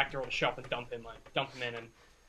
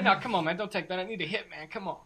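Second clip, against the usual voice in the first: higher-pitched begging addict; desperate junkie, pitched up; pleading druggie; 5 semitones higher than usual. Acted. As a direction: plead for one more hit. Game master voices the captive.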